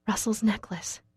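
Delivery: hushed, breathless whisper of recognition; stunned whisper. A narrator's reading. Narrator whispering as she recognizes Russell's bloody necklace. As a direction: barely voice it, in shock.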